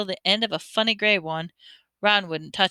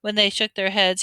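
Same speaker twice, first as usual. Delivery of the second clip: plain reading voice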